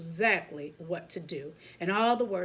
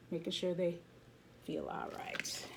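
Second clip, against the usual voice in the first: hushed; 8 dB quieter than usual. A narrator's reading. Gentle ending of phrase.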